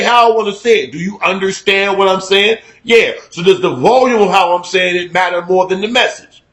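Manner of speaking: usual speaking voice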